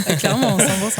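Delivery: laughing, loud and bright. Real, not acted. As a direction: laugh through it. Laughing admission of spying.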